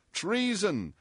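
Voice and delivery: ordinary delivery, nothing marked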